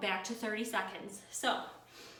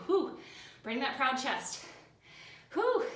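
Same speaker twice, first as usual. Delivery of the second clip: effortful gasps; 4 semitones higher, 4 dB louder, slower than usual. Real, not acted. Straining through final seconds.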